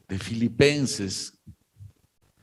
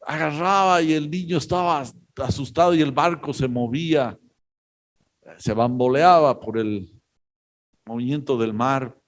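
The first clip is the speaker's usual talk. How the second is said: ordinary talk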